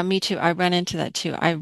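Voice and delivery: normal talking voice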